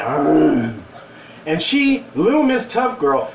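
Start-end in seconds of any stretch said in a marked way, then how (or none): 0.0-0.7 growl at her. Retold or imitated. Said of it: "And I went".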